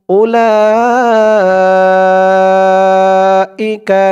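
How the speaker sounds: "উলাইকা" with "লা" held very long; four-alif elongation. Shown as a demonstration. Teacher applying the same rule, "উলাইকা" drawn out long. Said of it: "এখানে যে নিয়মে পড়েছি এখানেও".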